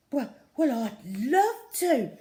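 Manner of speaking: loud, slow, flustered splutter; tripping over the words in delight. Acted. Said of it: "spluttered Tina".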